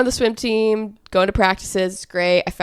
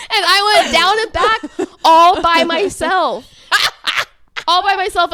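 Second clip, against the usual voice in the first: laughing through the story; 9 semitones higher, 6 dB louder than usual. Real, not acted. Recalling her false start, laughing through the words. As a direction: tell it laughing at yourself. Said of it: "laugh cry".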